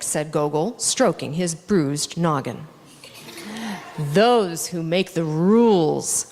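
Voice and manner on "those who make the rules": a slow, lightly growled dog's line; a hint of a gruff old dog growling. A narrator's reading. Pushkin the dog answers Nikolai, lightly growled. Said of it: "growled Pushkin".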